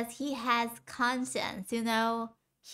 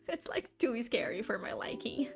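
whisper; 3 dB quieter than usual. Real, not acted. Scared confession, voice drops.

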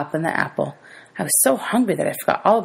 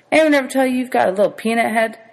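teasing; 8 semitones higher, 3 dB louder than usual. A narrator's reading. Driver teases Bud.

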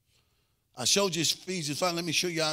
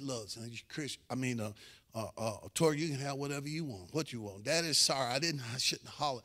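low, soft, contrite parent; hushed, halting apology to a son; gentle apology; 3 semitones lower, 6 dB quieter than usual. Retold or imitated. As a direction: re-enact humbly making up with your child. Re-enacts apologizing to his son.

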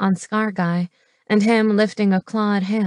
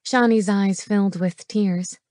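ordinary delivery

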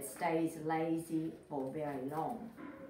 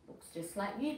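plain reading voice

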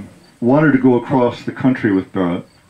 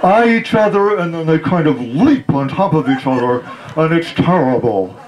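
lecturing father; 6 semitones higher than usual. Retold or imitated. Father lecturing on men and women.

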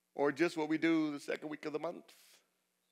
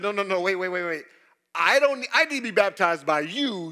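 John begging Jesus to stop, loud; John protesting; 7 dB louder than usual. Acted. John pleading with Jesus to baptize him instead, loud urgent protest. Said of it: "And John tried to deter him, said".

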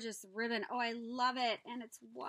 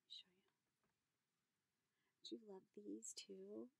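hushed unvoiced whisper; 3 semitones lower, 13 dB quieter, much slower than usual. Real, not acted. Showing the viewers a tag, whisper. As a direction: confide it to the viewers.

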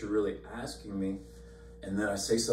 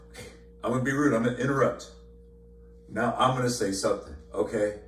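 fast, louder push to break in; hurried resolve; 2 semitones higher, 4 dB louder, faster than usual. Retold or imitated. Preacher bracing to interrupt the couple, fast and pushy. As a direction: rush it like you're about to interrupt. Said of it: "I'm like".

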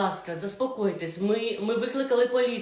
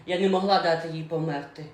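ordinary talk, nothing marked

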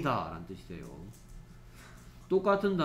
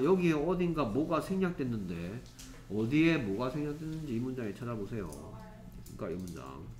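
ordinary talk

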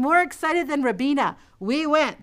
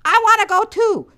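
put-on eager toddler; 7 semitones higher, 6 dB louder than usual. Toddler wanting to join.

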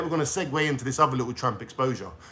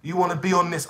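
ordinary talk

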